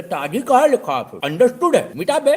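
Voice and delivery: escalating mock rant; comic shout. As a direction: shout the shopkeeper down. Know-it-all orders word removed.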